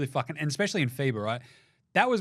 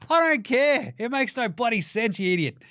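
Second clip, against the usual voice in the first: much higher, louder, incredulous; exasperated rant; 8 semitones higher, 4 dB louder than usual. Real, not acted. Host ranting at Team USA over their Avengers name, loud. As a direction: yell at the absurd name.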